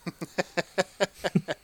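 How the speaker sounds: high laughing burst; burst of laughter. Real, not acted. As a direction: laugh out loud. Laughing at the reading.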